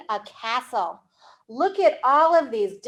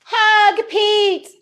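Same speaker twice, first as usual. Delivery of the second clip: high, louder cheerful reveal; happy surprise; 8 semitones higher, 5 dB louder than usual. Acted. Storyteller revealing the monster's kind wish. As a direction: beam it out as the twist.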